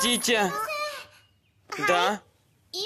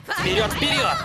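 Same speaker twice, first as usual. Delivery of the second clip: high cheering shout; cheerleader; 10 semitones higher than usual. Acted. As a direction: cheer them on at full voice. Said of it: "Мио у нас знатная болельщица". (кричалка болельщицы)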